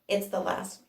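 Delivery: ordinary talk, nothing marked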